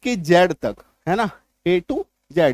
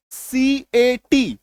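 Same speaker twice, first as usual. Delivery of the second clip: spelled out slowly; 3 semitones higher, much slower than usual. Shown as a demonstration. Teacher spelling to students, slow. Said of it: "क्या पढ़ते हैं आप इसको?".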